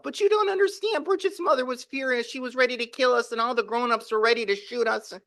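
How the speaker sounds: put-on a kid desperate to be believed; upset child insisting. The boy insists to his mother, high and frantic.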